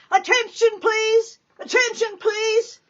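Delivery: the nun's call cutting through the crowded waiting room. Acted. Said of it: "she heard Sister Ursula call and clap".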